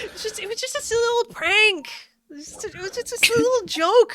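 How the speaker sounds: high mocking voice shrugging off the deaths as a joke; mock excuse voice. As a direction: brush off a massacre as a prank in a put-on high voice.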